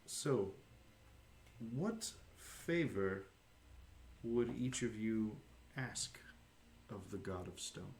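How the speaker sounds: slow, spaced-out solemn offer; measured healer addressing the party, trailing softly; solemn NPC question. Acted. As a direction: pose it gravely, fading at the end. Game master voices Gurnik.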